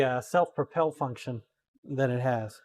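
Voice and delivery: ordinary delivery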